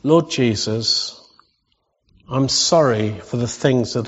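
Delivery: slow prayer with long pauses between phrases; slow prayer reading. Real, not acted. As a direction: read it phrase by phrase so others can follow. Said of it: "I'll read it quite slowly".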